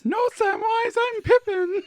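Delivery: high squeaky hobbit voice, giggling; hammed-up high Pippin squeak; Pippin impression. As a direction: pipe up like a silly hobbit. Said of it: "So now you've gotta do pepper".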